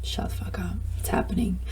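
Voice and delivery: unvoiced playful hush; joking whispered shut up. Speaker joking with friends who refuse help, whispered. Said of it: "And I'll be like".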